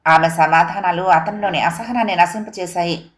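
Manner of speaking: usual speaking voice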